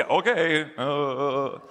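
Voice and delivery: dazed, blank voice of a hypnotized disciple. Acted. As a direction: answer like you're in a trance. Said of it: "they become hypnotized".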